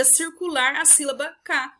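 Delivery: normal talking voice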